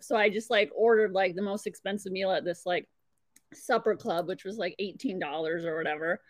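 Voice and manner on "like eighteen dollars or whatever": ordinary delivery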